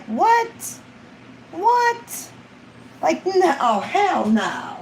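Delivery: high incredulous what; shocked double take; stunned outrage at abuse; disbelief. Real, not acted. Reacting to child abuse story.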